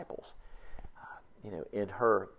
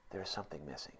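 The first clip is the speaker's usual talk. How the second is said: hushed echo; 10 dB quieter, faster than usual. Real, not acted. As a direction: drop to near hush for the echo. Sermon echo, dropped to hush.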